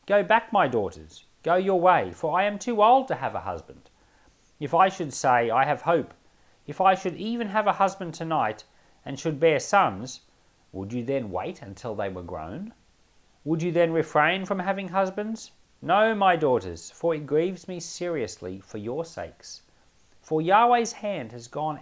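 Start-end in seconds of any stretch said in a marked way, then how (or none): none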